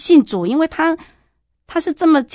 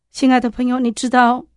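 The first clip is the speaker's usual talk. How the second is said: ordinary talk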